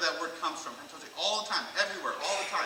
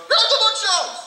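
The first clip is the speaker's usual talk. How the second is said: very high, loud frantic shout; panicked yell; 12 dB louder than usual. Retold or imitated. Brother yelling at little sister, panicked. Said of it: "he panicked and goes".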